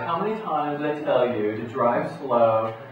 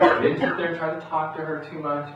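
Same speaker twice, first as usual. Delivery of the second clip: normal talking voice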